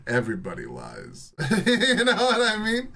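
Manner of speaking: cracking up between phrases; laughing. Real, not acted. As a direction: break into real laughter at your own joke. Cracks up at own claim.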